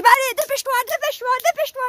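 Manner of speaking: high, loud impatient urging; urgent hurry up. Retold or imitated. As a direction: push him to hurry, high and insistent.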